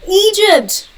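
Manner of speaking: high, loud burst of excitement; excited exclamation. Real, not acted. Thrilled at viewer being from Egypt.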